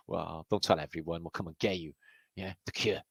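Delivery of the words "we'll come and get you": ordinary talk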